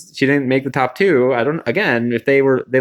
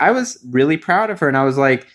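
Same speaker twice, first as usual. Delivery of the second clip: ordinary talk, nothing marked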